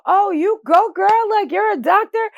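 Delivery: loud, high-pitched whoop of admiration; retold amazed cheer. Mother congratulating a young woman doctor, excited retelling.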